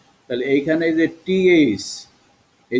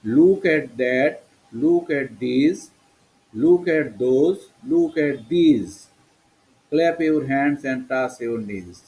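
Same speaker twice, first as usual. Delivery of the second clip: very slow, word-by-word classroom chant; drawn out for pupils to repeat; listen-and-say model; much slower than usual. Shown as a demonstration. (শেখানোর জন্য খুব ধীরে টেনে টেনে)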